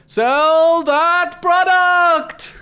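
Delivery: very high, loud and slow, stretched out; hammed-up command. The hobgoblin host orders the next pitch, very high slow loud shout. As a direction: drag out the order like a showman. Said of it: "And she says".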